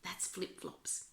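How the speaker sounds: whispered aside to the listeners. Real, not acted. Reader explains to UK friends in a whisper. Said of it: "And for my U K friends".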